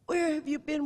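high, pleading question; imitating Lloyd. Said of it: "And he said".

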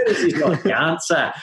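laughing, half-hearted Scottish accent; a giggly, over-the-top Scottish accent; attempted Scottish accent. Shown as a demonstration. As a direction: try a Scottish accent and crack up. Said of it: "Can, can you say that again in his accent?".

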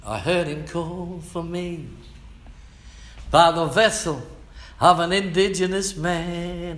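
high, rising preacher's call; awed testimony. Real, not acted. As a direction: build it up with wonder. Preacher testimony rising to full force.

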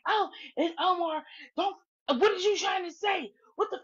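high-pitched mock tirade; going off. Woman turning on Omar in the chat, mock tirade. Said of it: "she started going off on Omar".

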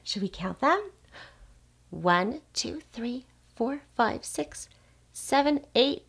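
plain reading voice

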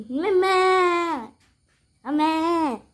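high, drawn-out call across the yard; calling the cow, high and ringing. Real, not acted. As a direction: call the mother cow from afar.

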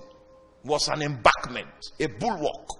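high, loud pulpit delivery; forceful preaching. Real, not acted. Preacher telling the congregation about the men making the gate, forceful preaching. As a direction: ring it out to the whole congregation.

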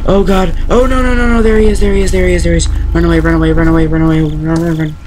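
panicked, high and loud; frantic pleading while fleeing; being chased. Real, not acted.

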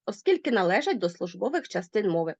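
ordinary talk

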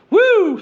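a high whooping cry as money flies. Pretend. Vlogger mimics cash flying off, a high giddy woo.

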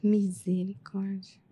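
slow, whispered exclamation; hushed dismay. Real, not acted. Listener reacting to the story of a hidden injury, hushed dismay. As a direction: whisper it, drawn out and very soft.

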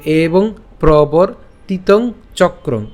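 slow, separated word by word; deliberate syllable-group demo with short breaks; broken-up pronunciation. Shown as a demonstration. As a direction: teach it in small drawn-out pieces. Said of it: "উচ্চারণটা একটু ভেঙে দিয়েছি".

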